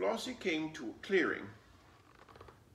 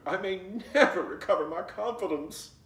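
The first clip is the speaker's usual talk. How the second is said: loud lament; 7 semitones higher, 9 dB louder than usual. A narrator's reading. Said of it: "Fox hollered".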